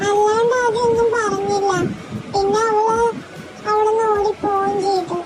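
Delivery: slow, halting words through sobs; tearful slow sobbing account; crying. Acted. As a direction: explain it while crying, slowly. Girl tearfully explains the fall.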